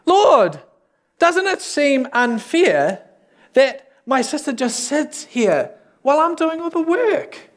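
high, indignant plea; hammed-up complaint, pitched high all through; agitated Martha. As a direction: protest up high, stretching the grievance. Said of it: "Martha gets so agitated".

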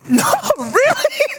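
laughing, high-pitched incredulous squeak; mock disbelief. Pastor whose joke got nothing from the crowd, mock disbelief. Joke falls flat with the congregation.

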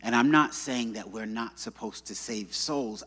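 usual speaking voice

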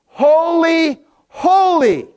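high, held cry of worship; angels' song of praise. Acted. Angels singing to God, high reverent acclamation. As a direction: proclaim each word high and drawn out. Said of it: "they sing".

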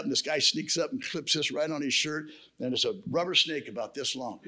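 ordinary talk

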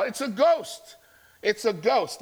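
mock terrified yell of the disciples; retold yell. Disciples in the storm seeing Jesus, mock terrified yell. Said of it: "And they yell".

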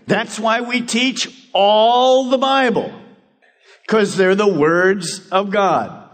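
rally the church with rising fervor; preaching at full force. Acted. Pastor urges the congregation to go make disciples, preaching hard.

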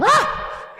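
sudden high yelp of escaping voice; high squeak. Shown as a demonstration. Ventriloquist's runaway voice letting out a squeal. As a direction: squeal as the voice gets loose. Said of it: "It got out".

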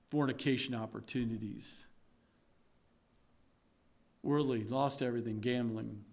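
a ruined man owning up; quiet confession. Acted. Voicing a fallen sinner confessing.

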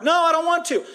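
put-on high, loud childish no; hammed-up stubborn student; stubborn refusal. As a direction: dig in your heels, mock pouty. Stubborn younger self refuses.

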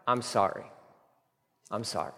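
soft, low, contrite apology; quiet apology. Acted. Preacher voicing the prayer Jonah should have prayed, quiet and contrite. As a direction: say sorry humbly, dropping almost to nothing.

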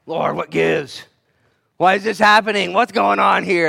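loud, frustrated questioning; exasperated plea. Acted. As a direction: act out a bewildered complaint to God. Acts out questioning God in trial.

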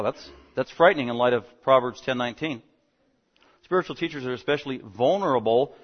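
normal talking voice

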